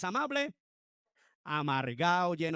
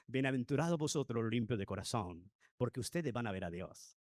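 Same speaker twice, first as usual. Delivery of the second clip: gentle beatitude; 6 semitones lower, 3 dB quieter than usual. Shown as a demonstration. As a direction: lower and softer, calm and kind. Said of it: "No, no me lo imagino así". (bienaventuranza dicha con calma, grave y suave)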